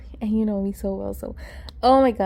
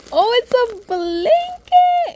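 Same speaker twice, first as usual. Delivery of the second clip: delighted squeal; 13 semitones higher, 5 dB louder, slower than usual. Real, not acted. Delighted by the blanket.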